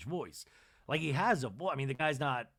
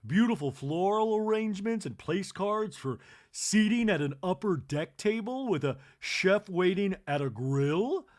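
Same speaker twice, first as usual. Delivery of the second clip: loud, slow swooning delight; delighted gush; 5 semitones higher, 7 dB louder than usual. Retold or imitated. Kathy admiring the deck, loud delight. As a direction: gush over the table. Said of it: "she goes".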